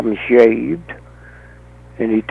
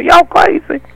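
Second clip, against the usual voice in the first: incredulous exclaim; 4 dB louder than usual. Acted. Mocking disbelief at believers.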